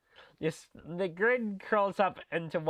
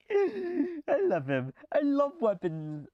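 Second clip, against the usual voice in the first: high, slow squeal through laughter; squealing love; 11 semitones higher, slower than usual. Real, not acted. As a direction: gush like a smitten fan. Gushing over a game character.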